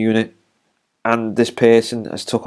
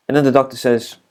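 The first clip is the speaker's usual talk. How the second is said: ordinary talk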